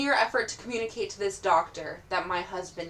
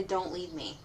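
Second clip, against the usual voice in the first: quiet plea; 4 semitones lower, 10 dB quieter, slower than usual. Pretend. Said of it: "I'm begging him".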